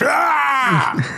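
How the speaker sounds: sudden high yelp; a shrill hidden scream in the tape; shout. Acted. Tape hides a sudden high yelp. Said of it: "It says".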